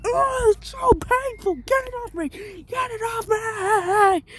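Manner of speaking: very high, pained, ending in a slow sob; crying squeal. Retold or imitated. Bakugo being punched, wailing into a mock cry. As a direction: wail it until it breaks into crying. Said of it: "Bakugo's like".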